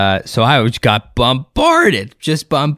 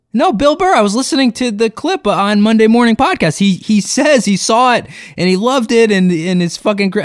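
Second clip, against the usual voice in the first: insistent fan; 9 semitones higher than usual. Retold or imitated. Fan insists, arguing back.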